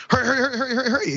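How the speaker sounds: high, hurried mock urgency; 高声催促; urgent official. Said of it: "Rolls up and goes".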